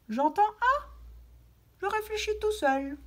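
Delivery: high, bright thinking-aloud voice; child thinking aloud. Shown as a demonstration. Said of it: "model thinking aloud". (voix d'enfant qui réfléchit tout haut)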